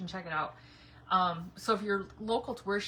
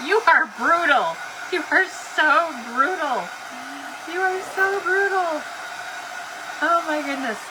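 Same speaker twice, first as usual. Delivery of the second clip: loud, slow, drawn-out teasing accusation; mock outrage; 7 semitones higher, 9 dB louder than usual. Real, not acted. Streamer to a viewer who banned peeing breaks, loud mock outrage. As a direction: call her brutal, loud and slow, rising higher.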